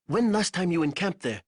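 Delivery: a guide asking a worried question; lightly voiced Ko. A narrator's reading. Narrator voices Ko.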